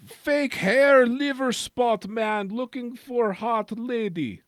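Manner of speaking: high, slow broken English voice; squeaky confused foreign old man; mock broken English.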